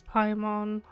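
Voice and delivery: normal talking voice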